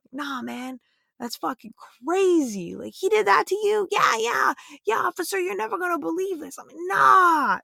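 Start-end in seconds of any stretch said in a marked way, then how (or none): 0.1-6.6 put-on street accent. Officer chats with an angry guy in a street accent. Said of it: "I just kinda rolled out my street accent". 6.8-7.6 put-on much higher, louder street accent, swearing along. Officer chats with an angry guy in a street accent. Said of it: "rolled out my street accent".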